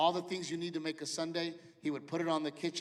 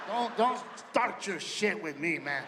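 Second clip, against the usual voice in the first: gruff dad; 4 dB louder, slower than usual. Retold or imitated. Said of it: "his first response is always".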